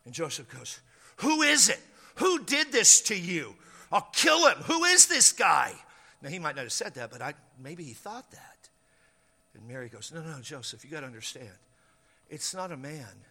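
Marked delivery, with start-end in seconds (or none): 1.2-5.7 loud, high-pitched furious demand; raised-voice jealous rage; angry outburst. Acted. Joseph demands the man's name. 10.1-13.2 low, hushed explanation; calm him down very quietly; gentle, subdued insistence; soft pleading. Acted. Mary pleads with angry Joseph.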